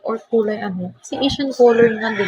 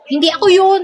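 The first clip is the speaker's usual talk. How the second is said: high, loud protest; loud denial; 9 semitones higher, 6 dB louder, faster than usual. Real, not acted. Streamer loudly denying to chat that it was her. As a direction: yelp it's not me, louder. Denies being the one shown.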